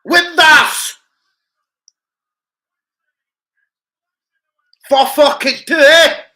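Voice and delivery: loud high yell; goal celebration. Real, not acted. Fan screaming at a goal on telly, loud and high. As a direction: roar at the goal.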